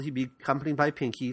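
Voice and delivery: normal talking voice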